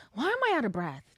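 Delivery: ordinary talk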